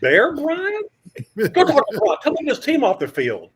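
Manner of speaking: high, loud disbelieving squawk with a laugh in it; mock outrage at being told to ask Bryant; incredulous producer. Producer on the headset, disbelieving, loud and high.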